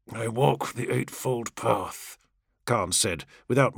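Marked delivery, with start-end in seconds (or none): 0.1-2.2 eerie dead voice. A narrator's reading. Dead captain speaks.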